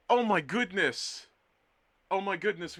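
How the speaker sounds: high, loud burst of delight; thrilled to see a friend join; excited exclaim. Real, not acted. Greeting a new chatter, loud and delighted.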